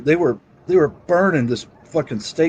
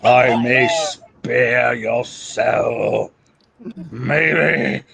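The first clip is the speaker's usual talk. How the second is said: slow menacing demon voice; booming demon lord; much slower than usual. Pretend. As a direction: loom over them, slow and dark. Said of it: "talk like that in the rest of the stream".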